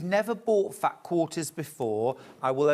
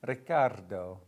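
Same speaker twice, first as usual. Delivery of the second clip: "Riccardo" with an over-rolled R, lower and heavier; an even clumsier Italian-ish "Riccardo"; worse mock Mediterranean accent; 6 semitones lower than usual. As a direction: push the rolled R on "Riccardo" until it falls apart. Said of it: "No, it gets worse".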